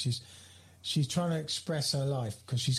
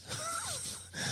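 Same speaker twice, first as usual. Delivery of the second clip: laugh; 9 dB quieter than usual. Real, not acted. Laughing at the butterflies.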